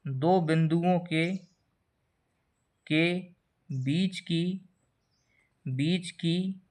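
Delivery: slow, word by word, as if dictating notes; slow dictation. Shown as a demonstration. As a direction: spell out the definition slowly for writing. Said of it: "जैसे मैं आपको परिभाषा लिखूं".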